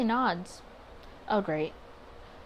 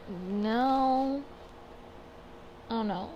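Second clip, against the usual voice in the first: ordinary talk, nothing marked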